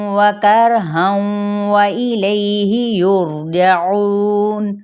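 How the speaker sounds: slow tilawat with held vowels; Quranic recitation. Shown as a demonstration. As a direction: recite with long ringing vowels, pausing between phrases. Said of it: "अब वाला हू".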